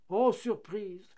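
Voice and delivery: high, loud joyful exclamation; excited little shout; delighted cry. A narrator's reading. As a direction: cry out in happy surprise. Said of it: "s'écria le papier".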